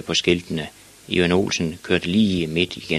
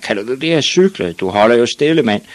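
loud, higher bully voice; lightly acted bully taunt; jeering big boy; 4 semitones higher, 6 dB louder than usual. A narrator's reading. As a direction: scoff loudly at a small kid.

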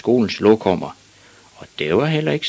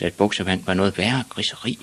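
ordinary delivery, nothing marked

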